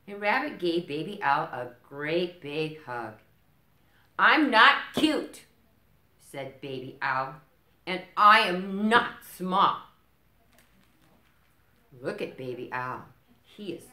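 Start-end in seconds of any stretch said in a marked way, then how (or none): 4.2-5.5 high, loud, slow indignant denial; loud, high, stubborn little owl; indignant protest. Acted. 7.9-9.8 loud cross insistence; loud, stubborn little owl; indignant protest. Acted.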